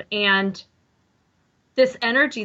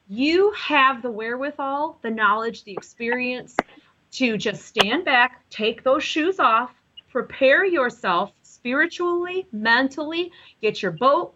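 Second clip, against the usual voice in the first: ordinary talk, nothing marked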